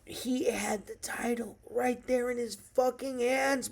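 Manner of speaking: hushed disbelieving whisper; whispered, stunned by the loss. Real, not acted. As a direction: breathe it out in disbelief. Disbelief at the fumble.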